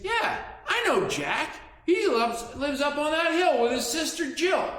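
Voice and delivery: high, eager squirrel; high-pitched storybook squirrel. Acted. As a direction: chatter helpfully in a high animal voice. Squirrel's answer translated.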